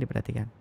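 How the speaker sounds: "Diperhatikan" low, soft and level; without intonation. Shown as a demonstration. Lecturer repeating the request to students, toneless and subdued, without intonation. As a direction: drop "Diperhatikan" with no emphasis at all. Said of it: "Tanpa intonasi".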